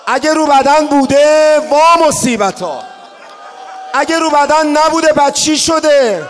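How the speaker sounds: loud rising lament, crying out the questions; impassioned mourning sermon; preaching at full force. Acted. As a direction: build to an anguished public outcry.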